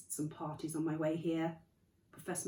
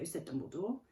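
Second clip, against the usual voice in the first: ordinary delivery, nothing marked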